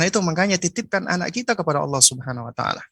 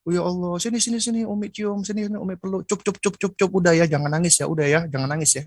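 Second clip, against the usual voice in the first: doting mother; 4 semitones higher than usual. Retold or imitated. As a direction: coo like a mother spoiling her crying child. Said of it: "memanjakan sang anak".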